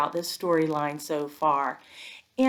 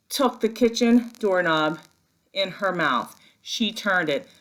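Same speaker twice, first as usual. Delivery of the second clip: ordinary delivery, nothing marked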